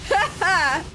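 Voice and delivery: high, long, shouted evil laughter; demon cackle. Acted. Demon laughing at the eggs, wild cackle.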